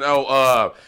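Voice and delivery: ordinary delivery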